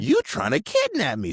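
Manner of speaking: very high, loud mock outrage; squawked retold protest, falling at the end; indignant alarm. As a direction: ham up the startled accusation. Repeats the acted alarm, bigger.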